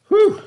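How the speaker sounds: loud high exhale of relief after the heat; a big hot-and-tired whew; relieved whew. Real, not acted. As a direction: blow it out like you're overheated. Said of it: "This is so hot".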